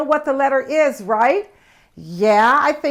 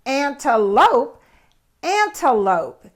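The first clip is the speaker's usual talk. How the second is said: slow model word; slower than usual. Shown as a demonstration. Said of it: "How about antelope?".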